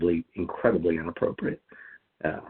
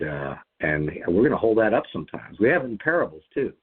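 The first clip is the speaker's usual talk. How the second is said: usual speaking voice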